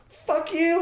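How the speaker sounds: high, spat curse; mock curse. Gym owner cursing the speaker, high and spat. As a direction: fling it across the gym. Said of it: "he's like".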